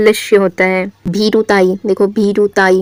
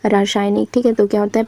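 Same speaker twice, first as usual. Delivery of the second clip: ordinary delivery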